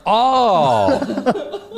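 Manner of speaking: high, laughing oh of realisation; a sudden penny-drop oh, laughing; amused surprised gasp. Retold or imitated. Realising fan's mistake.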